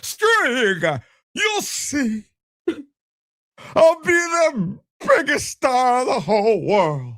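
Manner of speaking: high, crying mock tantrum; tantrum-throwing son. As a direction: whine defiantly in a strained high voice. Son's tantrum at family.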